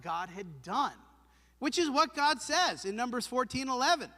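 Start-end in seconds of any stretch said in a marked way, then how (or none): none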